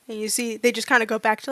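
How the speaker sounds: usual speaking voice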